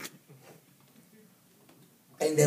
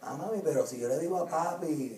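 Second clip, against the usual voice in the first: put-on manipulative kid; 7 dB quieter than usual. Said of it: "vengan con ese flow".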